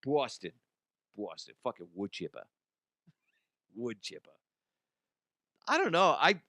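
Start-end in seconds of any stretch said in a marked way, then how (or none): 0.0-1.6 Boston said as "Bawston"; Boston with a rounded first vowel, trailing softer; attempted Boston accent. Shown as a demonstration. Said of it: "as good as I can get on a Boston accent". 1.6-4.3 soft, low Boston accent; Boston accent under the breath, dropped r's; attempted Boston accent. Shown as a demonstration. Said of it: "as good as I can get on a Boston accent".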